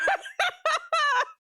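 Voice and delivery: sudden high laughing burst; high burst of laughter. Real, not acted. Laughs at game glitch.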